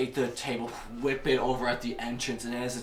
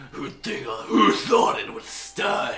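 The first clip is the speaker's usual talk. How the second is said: loud, rough amused creature voice; gloating Skiono; 10 semitones higher, 11 dB louder, slower than usual. Acted. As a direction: gloat loudly over the kill. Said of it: "he just".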